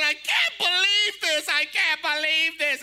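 loud tearful disbelief; screaming disbelief. Retold or imitated. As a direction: shout it stunned and overjoyed. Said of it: "And she's screaming".

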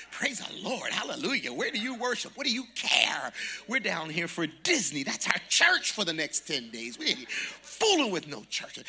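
loud hallelujah chatter; loud church excitement. Retold or imitated. Retelling loud churchy table talk.

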